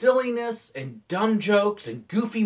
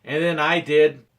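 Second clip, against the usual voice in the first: ordinary talk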